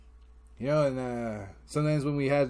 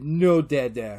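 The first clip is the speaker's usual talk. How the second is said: loud imitated refusal; lightly voiced child's brush-off; kid shutting Dad out; 7 dB louder, slower than usual. Kid closing the tent on dad, refusing. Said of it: "And he closes the tent".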